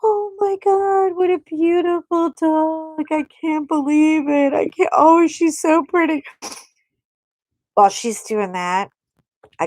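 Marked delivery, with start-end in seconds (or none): 0.0-6.2 gushing over a dog. Acted. Girl fawning over a stranger's beautiful dog, high mock gushing. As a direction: coo in mock rapture, way up high. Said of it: "she takes her hand off the necklace and goes".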